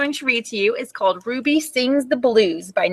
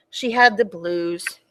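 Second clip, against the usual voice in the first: 1 sad; 4 semitones lower, slower than usual. A narrator's reading. Sad moment in story.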